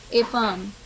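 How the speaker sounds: ordinary talk, nothing marked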